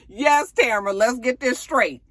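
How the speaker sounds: mock sassy agreement; sassy cheer. Speaker cheering on Tamara.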